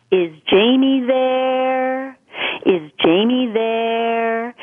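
put-on a man's French-accented voice, very slow, drawing out "there" as "theeere"; slow, faraway French-accented call, stretching "there"; slow French-accented ghost. Ghostly husband calling for Jamie on the phone, slow French accent. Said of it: "the voice had an accent and it extended the syllable there".

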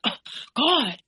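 high exasperated groan of words, imitated; mad, woken up. Woken boyfriend reacts to being asked for help, mock exasperation. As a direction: mimic him annoyed at being woken. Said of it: "he got really mad at me".

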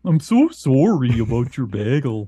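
hammed-up Northern Wisconsin accent, rounded vowels. Shown as a demonstration. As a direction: lay the Wisconsin accent on thick. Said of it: "That's how they say it in Wisconsin".

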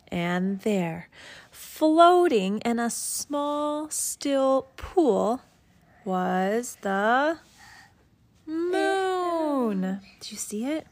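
lifted, hushed storybook wonder; story reading with wonder. Acted. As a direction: draw the child into the discovery. Parent reads picture book aloud.

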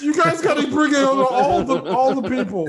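mock caller voice. Voicing the bike owner.

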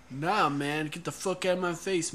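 usual speaking voice

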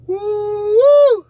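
very high, loud whoop; excited whoop. Real, not acted. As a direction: whoop as if at a show. Teasing [name] loudly.

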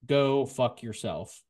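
normal talking voice

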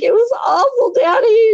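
high-pitched mock crying to Dad; hammed-up weepy whine; tearful wail. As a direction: wail it like an upset little daughter. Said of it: "I was crying".

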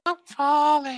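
very high falsetto plea; mock falling cry. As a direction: echo it as a comic falsetto damsel.

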